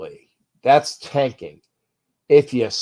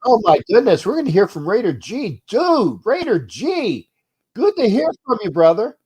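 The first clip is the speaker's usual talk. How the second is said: excited greeting; 8 semitones higher, 4 dB louder than usual. Real, not acted.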